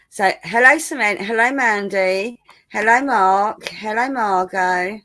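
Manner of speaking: put-on posh English greetings, drawn-out names; hammed-up posh accent. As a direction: greet everyone in a mock upper-class accent. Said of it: "I was putting on my posh voice".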